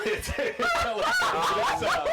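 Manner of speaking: high, loud, slow dramatic reveal. Acted. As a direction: reveal it with big wonder. GM narrating magic item.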